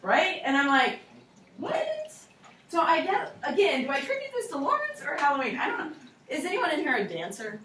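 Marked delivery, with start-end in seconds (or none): 1.6-2.1 what jumps up high in disbelief; a squeaky stunned what; shocked what. Retold or imitated. Teacher reacting to kids dressed as eggs, stunned high what. Surprised at purple eggs costumes.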